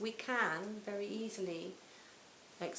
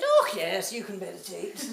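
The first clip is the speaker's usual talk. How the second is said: put-on a loud, jokey Scottish accent, laughing at the end; hammed-up Scottish accent, cheerful and booming; terrible Scottish accent; 10 dB louder than usual. Meditation teacher greeting a nervous newcomer, in a loud mock Scottish accent. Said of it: "it's a terrible Scottish accent".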